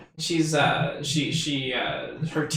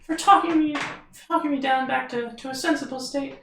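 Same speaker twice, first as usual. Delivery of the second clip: fairy voice; 10 semitones higher than usual. Acted. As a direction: thank them sweetly, tiny voice. Fairy thanks them.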